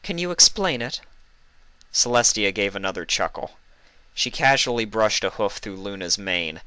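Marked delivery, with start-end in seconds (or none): 0.0-1.0 high, earnest request; pleading. Acted. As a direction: ask her sister for help. Luna pleading.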